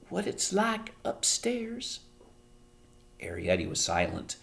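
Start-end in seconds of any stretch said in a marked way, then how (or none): 0.1-2.0 high, softer, slow; fearful hushed voice. A narrator's reading. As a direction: drop your voice in fear. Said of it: "dropped her voice to a fearful whisper".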